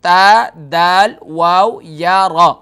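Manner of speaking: letters of تدویر called out slowly and loudly, one by one; spelling letters. Shown as a demonstration. Teacher spelling the word for viewers, emphatic. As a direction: spell تا دال واو یا را loud and slow. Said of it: "تدویر".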